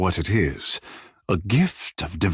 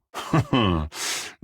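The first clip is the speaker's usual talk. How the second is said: a short amused chuckle, softer than the talk around it; light amused laugh; 2 semitones lower, 4 dB quieter than usual. Acted. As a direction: let a quiet laugh slip out. Character laughs between lines.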